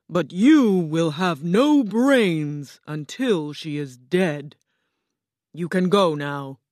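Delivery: lightly voiced stately enchantress announcing herself; grand Oz. A narrator's reading. Oz as green woman introduces herself, grandly slow. Oz dismisses Scarecrow.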